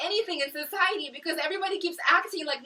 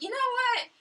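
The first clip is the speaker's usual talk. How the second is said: pitched way up, mock-cheery; sudden bright idea; 10 semitones higher than usual. To her viewers, mock-bright turn to baking. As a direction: jump high into a sing-song idea voice. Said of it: "I'm like".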